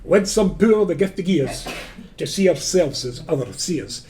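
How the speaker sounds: slow Scottish poetry recitation; Scottish accent. Shown as a demonstration. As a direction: recite Burns slowly in broad Scots. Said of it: "would you like it in Scottish or English".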